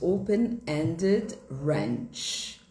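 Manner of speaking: open-ended range said slowly with an American r; carefully modelled American term; American English pronunciation. Shown as a demonstration. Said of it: "no inglês americano".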